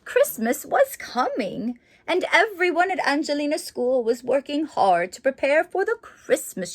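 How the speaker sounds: lively storybook narration; reading to children. A narrator's reading. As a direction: read it like a bedtime picture book. Reads the book aloud.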